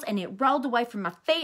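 ordinary delivery, nothing marked

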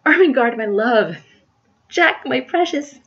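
loud, high, mounting endearments; rapturous lovers. Acted. Ermengarde and Jack trade endearments, rapturously. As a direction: swoon out each pet name.

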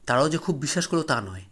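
plain reading voice

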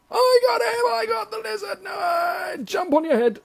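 high excited shout; excited yelling. Real, not acted. Gamer shouting with glee after catching a lizard.

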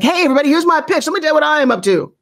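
high, fast, hammed-up announcer pitch; mock announcer rattling off a pitch, high and fast; billboard announcer. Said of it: "So stop sounding like".

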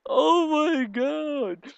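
can barely hold the laugh in; giggling. Real, not acted. Gamer picturing Lily kicking, high giggly laughter.

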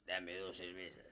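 put-on low, slow drunken order. Drunk in the confessional ordering beer, low and slow. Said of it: "el borracho le dice".

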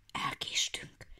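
breathy frightened whisper; hushed fox whisper. A narrator's reading. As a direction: whisper it in fear. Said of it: "suttogott Karak".

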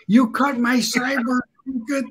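high-pitched irate caller, retold; hollering. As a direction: imitate an angry man hollering down the phone. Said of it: "And he's hollering".

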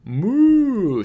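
very high, loud, sustained moo; long imitation moo, pushed up high; cow's moo. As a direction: call out like a cow. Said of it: "says the cow".

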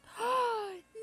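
high loud horrified gasp; sharp high gasp; shocked gasp. Real, not acted. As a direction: gasp as if it's falling. Character in danger.

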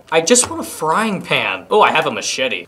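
ordinary delivery

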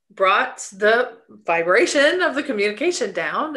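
ordinary talk, nothing marked

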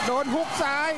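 excited. Real, not acted. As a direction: shout the knockdown. Knockdown call. (ตะโกนตื่นเต้น)

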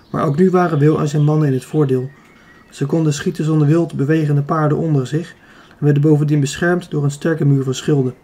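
ordinary delivery, nothing marked

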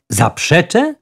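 high, rising astonished question; agitated echo, pitch climbing at the end; excited exclamation. A narrator's reading. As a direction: throw it back as an excited question. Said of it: "Zawołał podniecony sędzia".

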